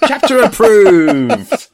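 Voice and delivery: usual speaking voice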